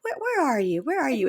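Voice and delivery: high-pitched pleading wife; Nicole. Retold or imitated. Wife questioning husband's behavior over the phone, mock pleading. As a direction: imitate a spouse gently remonstrating. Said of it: "she goes".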